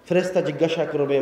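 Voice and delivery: ordinary talk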